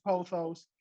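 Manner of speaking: pothos with a long "oh", POH-thos; pothos, POH-thos, the way she says it; pothos variant. Shown as a demonstration. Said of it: "Now, again".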